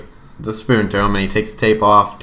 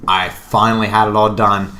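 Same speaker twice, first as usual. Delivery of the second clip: usual speaking voice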